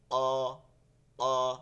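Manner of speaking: "অ" loud, full and rounded; "অ" as a weighty back-of-mouth aw; heavy, thick sound. Shown as a demonstration. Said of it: "ভারী এবং মোটা আওয়াজে হচ্ছে".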